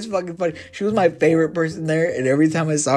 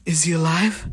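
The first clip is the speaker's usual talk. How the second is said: mock alarmed concern, starting high; worried gasp; 5 semitones higher than usual. Boss pretending to worry about the victim. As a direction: ask with hammed-up worry. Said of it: "We would always show concern".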